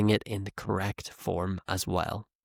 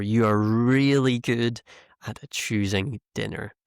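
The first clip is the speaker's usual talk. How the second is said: REALLY stretched out and punched; exaggerated, ironic emphasis on REALLY; sarcastic overemphasis. Shown as a demonstration. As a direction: lean hard on REALLY, teasing in mock praise. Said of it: "that emphasis on really".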